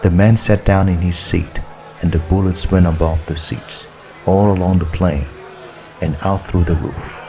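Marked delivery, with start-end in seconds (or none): none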